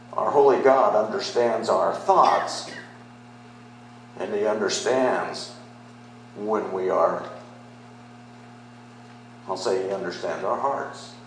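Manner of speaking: usual speaking voice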